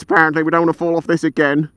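ordinary talk

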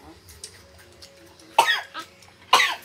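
harsh choking cough. Real, not acted. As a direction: cough hard, choking on spice.

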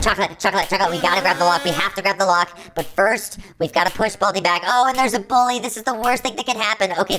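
ordinary delivery

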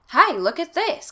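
high, loud, excited call. A narrator's reading. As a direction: call friends over eagerly. Boy calls others over.

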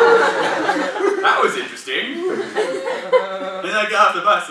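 high laughing drawl. Real, not acted. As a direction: still giggling at the memory. Still laughing after the story.

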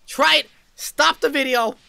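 high, loud eager push; urgent encouragement. Real, not acted. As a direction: urge them to try it. Urging viewers to breathe.

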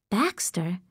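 breathless whispered disbelief; stunned whisper. Acted. Girl hearing the scandalous name, whispering in shock. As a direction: let the name slip out in shock.

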